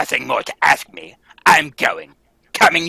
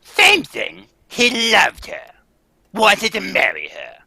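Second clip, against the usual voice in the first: mocking tone; 8 semitones higher than usual. Spiteful gossip about the clown.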